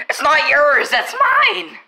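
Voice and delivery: high, loud playful claim; mock shout. Real, not acted. Claiming chipmunk from cat.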